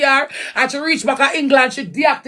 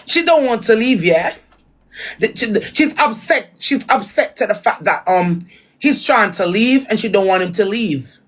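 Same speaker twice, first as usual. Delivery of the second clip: imitating English speech; mock English accent. Explaining that she's upset he wants to leave, mock English accent. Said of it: "I have the accent".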